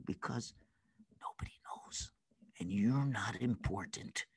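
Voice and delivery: very soft, low near-whisper; tempter's hush. Acted. Tempter telling a child nobody knows, hushed and low. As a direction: drop to a secretive, insinuating hush.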